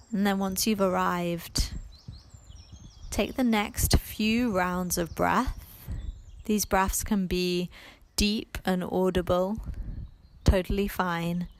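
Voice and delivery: unhurried meditation voice with long pauses; guided meditation. Acted. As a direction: ease the listener into letting go, phrase by phrase.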